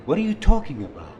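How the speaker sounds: high, loud, quick protest; defensive surprise. Acted. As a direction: snap back, caught off guard. Defensive reply to accusation.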